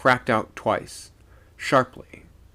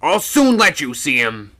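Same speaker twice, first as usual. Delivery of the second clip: loud angry threat, pitched up; thoroughly angry; 5 semitones higher, 9 dB louder than usual. A narrator's reading. Old man answering the second mate, thoroughly angry. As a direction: threaten him in a raised voice. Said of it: "now thoroughly angry".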